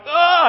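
sudden high cry of alarm. Retold or imitated. Father reliving the moment he sees his daughter, alarmed high cry. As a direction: gasp out a startled high ah. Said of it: "and I'm like".

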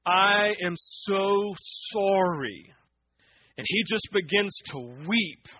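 loud, rising preacher's build; preaching at full force. Acted. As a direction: drive it home like a revival preacher.

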